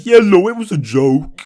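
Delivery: loud, high, drawn-out retort; mocking outburst. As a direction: yell it back in exaggerated mockery. Acting out his angry comeback.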